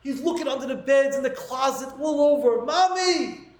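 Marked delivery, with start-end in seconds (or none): 2.6-3.5 high, wailing Mommy; crying out for mommy. Retold or imitated. Rabbi voicing a small boy crying for his mother, high wail. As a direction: let it break like a lost child. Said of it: "he's crying hysterical".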